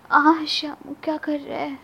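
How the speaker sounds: mock aroused gasp. Narrator voices her own arousal.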